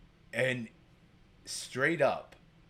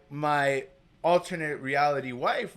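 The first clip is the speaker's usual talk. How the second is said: ordinary talk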